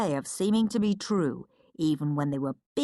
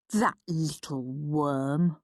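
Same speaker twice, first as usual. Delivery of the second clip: Henry's sneer; 4 semitones lower, much slower than usual. Acted. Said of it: "thought horrid Henry".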